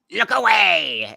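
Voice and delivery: high, loud, drawn-out cry; mock dramatic warning. Master of disguise before putting on the mask, hammed-up warning. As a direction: stretch it out theatrically. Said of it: "It's like".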